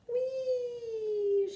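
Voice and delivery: very high joyful squeal; high happy shout on the rocking chair; gleeful whee. A narrator's reading. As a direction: squeal with delight. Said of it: "she shouted".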